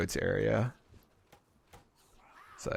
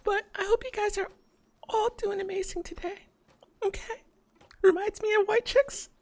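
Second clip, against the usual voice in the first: put-on very high cutesy e-girl voice; sweet high-pitched streamer girl. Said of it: "E-grill voice".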